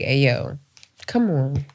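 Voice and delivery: soft, low 'come on'; softly exasperated, retold; low-key appeal. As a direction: quietly plead for seriousness, low in pitch. Acts out reasoning with unserious friend.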